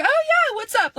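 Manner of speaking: chirpy mock-innocent friend voice; fake cheery friend. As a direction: imitate her sing-song innocent hello. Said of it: "And she's like".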